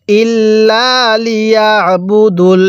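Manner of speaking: slow chant of ইল্লা লিয়াবুদুল with a long লা; drawn-out recitation. Shown as a demonstration. Teacher joins the spelt syllables for students, slow recitation. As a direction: recite ইল্লা লিয়াবুদুল slowly, vowels held level.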